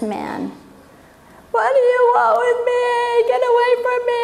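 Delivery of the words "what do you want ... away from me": high, fast, frantic cry; possessed man. Retold or imitated. Demon-possessed man shouting at Jesus, frantic. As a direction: push him away in a panic.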